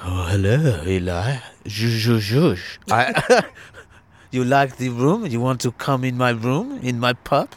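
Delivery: low, put-on foreign-sounding woman; playful impression in a low, accented voice; impression of Vera. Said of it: "She speaks like this".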